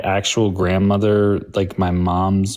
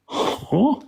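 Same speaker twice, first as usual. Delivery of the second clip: theatrical huh; 13 semitones higher, 3 dB quieter than usual. Pretend. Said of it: "that theatrical face thing".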